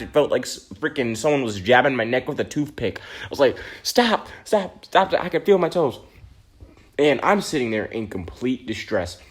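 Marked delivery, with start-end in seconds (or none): 3.8-6.0 high, desperate begging; pleading. Retold or imitated. Boy in pain begging teachers to stop tickling, high desperate plea.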